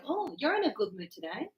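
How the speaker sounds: soft imitated remark to a cheery colleague; subdued aside. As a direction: remark softly, almost to yourself. Quiet aside reacting to cheery colleague.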